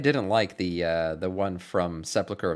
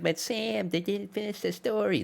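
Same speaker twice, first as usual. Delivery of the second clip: high whiny complaining fan; 10 semitones higher, slower than usual. Retold or imitated. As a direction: imitate a whining fan, pitched up. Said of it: "everyone's like".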